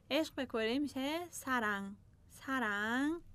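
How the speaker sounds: normal talking voice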